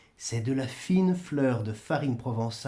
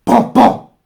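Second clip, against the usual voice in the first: coups frappés imités; 6 semitones higher, 13 dB louder than usual. Acted. On frappe à la porte.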